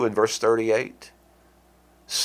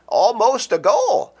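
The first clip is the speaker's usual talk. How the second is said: laughing, much higher and slow; amused laugh on "goal", higher and slower; 12 semitones higher, 4 dB louder, slower than usual. Real, not acted. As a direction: chuckle out "Almost a goal" high and drawn out. Laughing at a near miss.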